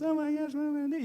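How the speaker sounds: high-pitched imitation of panicking; mock panic. Acts own past panic.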